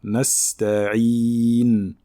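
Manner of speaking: nasta'in with a full clear I sound, drawn out; full kasra. Shown as a demonstration. Teacher repeats the correct kasra for students. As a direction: lengthen the I in nasta'in. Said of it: "un son I complet".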